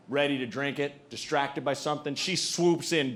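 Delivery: ordinary delivery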